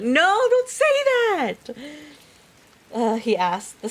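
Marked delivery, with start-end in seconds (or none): 0.0-1.8 high drawn-out shout of denial; yelling at the book. Real, not acted. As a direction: scream no at the page. Reader yelling at book twist.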